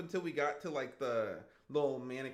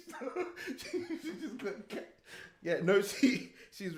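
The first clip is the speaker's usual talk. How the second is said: helpless giggles between broken-off words; laughing; 11 semitones higher, 5 dB louder than usual. Real, not acted. Dad cracking up over his smart daughter, laughing. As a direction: try to talk through a fit of laughter. Cracking up while telling story.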